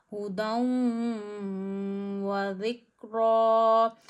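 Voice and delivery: हुदाउ व जिक्रा with the nasal held, very slow and loud; ghunna recitation. Shown as a demonstration. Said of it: "one finger जितना". (हुदाउ व जिक्रा गुन्ना के साथ)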